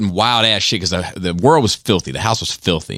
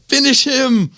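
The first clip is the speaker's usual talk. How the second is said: much higher mock game-announcer call; onlookers egging on; slower than usual. Bystanders urging on a fighter, high mock call. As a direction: imitate a crowd urging the final blow. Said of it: "then people would be like".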